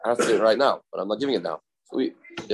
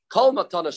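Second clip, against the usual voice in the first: "Kol" pitched up and leaned on; stress on Kol; 6 semitones higher than usual. Shown as a demonstration. Teacher showing the Mishnah's emphasis, stress on "Kol". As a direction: lean on the word "Kol", starting it high. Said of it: "emphasized, and it said Kol".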